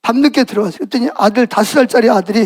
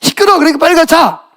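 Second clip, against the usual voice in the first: high, irritated scolding; annoyed; 5 semitones higher than usual. Acted. Irritated father sending his little son to bed, annoyed. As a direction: send the child off to bed crossly. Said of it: "아빠가 막 짜증이 나요".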